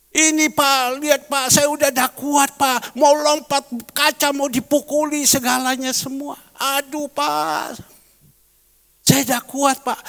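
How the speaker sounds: imitating a frantic plea; mock desperate plea. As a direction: play the distraught young person begging the pastor. Retold desperate complaint to pastor.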